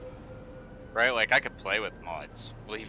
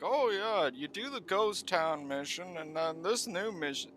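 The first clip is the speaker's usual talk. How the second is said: mocking sarcastic voice; 6 semitones higher, 7 dB quieter than usual. Mocking the game's mission setup.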